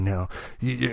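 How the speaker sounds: ordinary delivery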